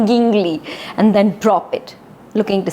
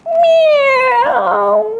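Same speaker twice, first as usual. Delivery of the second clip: high, frightened kitten mew; timid kitten meow; scared cat meow; 4 dB louder than usual. Shown as a demonstration. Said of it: "Can you pretend to be Stripes, who's scared?".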